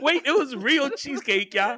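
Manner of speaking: high-pitched protest; exasperated insistence. Real, not acted. Speaker telling teasing friends there's no innuendo, exasperated insistence. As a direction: plead to be believed, voice jumping up high.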